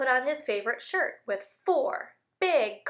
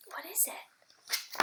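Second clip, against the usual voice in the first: suspense whisper; 4 semitones higher, 13 dB quieter, slower than usual. Acted. Teacher builds suspense before reveal.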